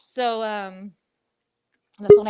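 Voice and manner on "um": ordinary delivery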